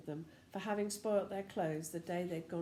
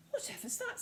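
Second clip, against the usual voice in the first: startled; 13 semitones higher, faster than usual. Acted. Bessie startled awake.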